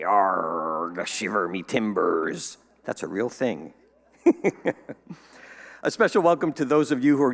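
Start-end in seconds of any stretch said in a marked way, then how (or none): 0.0-2.6 low, slow growly pirate. Pretend. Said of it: "International Talk Like a Pirate Day". 4.2-5.1 high bright burst of laughter; laughing. Real, not acted. Laughs at own pirate bit.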